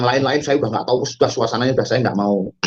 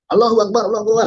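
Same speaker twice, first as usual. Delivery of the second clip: high-pitched chanted call; retold rallying cry; 9 semitones higher, faster than usual. Rioter calling to the crowd, high retold rallying cry.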